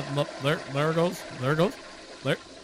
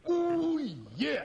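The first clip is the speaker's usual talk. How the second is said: pleased moan; 11 semitones higher, 4 dB quieter than usual. Retold or imitated. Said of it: "and you're just like".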